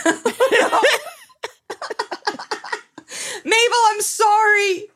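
high, helpless giggling apology to the dog; laughing apology. Real, not acted. Laughing at herself, she apologizes to Mabel the dog. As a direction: giggle through the sorry, can't stop.

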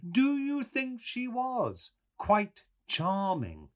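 slow, high, fussily sweet; finical sweet voice. A narrator's reading. Mulligan blinking pleasantly at Stephen, finically sweet. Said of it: "in a finical, sweet voice".

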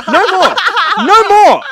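high, loud cry of protest; outraged shout. Acted. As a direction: yell it like you've had enough. Mock outrage over dog killing.

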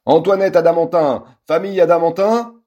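loud, high official call; shouting. Acted. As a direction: call out loudly through the door. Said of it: "quelqu'un crie derrière lui".

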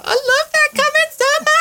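very high-pitched squeal of delight; gushing fan. Retold or imitated. Retelling own delighted reply to idol.

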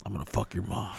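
mock tough guy.

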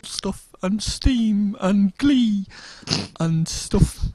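slow, high, camp voice; camp Steve. Retold or imitated. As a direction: drag it out, very camp. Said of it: "my really camp Steve impression".

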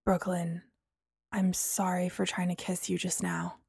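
low, very soft murmured apology. A narrator's reading. Apollo apologizing to Brooklyn for the kiss, murmured. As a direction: murmur it gently, well under normal volume. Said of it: "Apollo murmured".

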